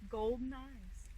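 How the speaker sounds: usual speaking voice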